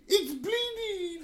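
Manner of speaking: high, slow horror-film scream; panicked cry. Acted. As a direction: wail it like a movie victim. Horror movie victim cry.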